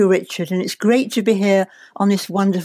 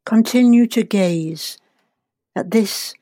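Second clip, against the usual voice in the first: guided meditation. Acted. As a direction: ease them gently into relaxation.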